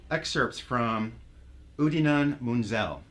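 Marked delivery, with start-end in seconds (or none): 1.8-3.0 "[name]" said slowly with the vowels mangled; deliberately botched name. Pretend. Host botches a performer's name for a laugh, "[name]" slowly garbled. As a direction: stretch out "[name]" as a careful mispronunciation. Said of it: "I did that intentionally".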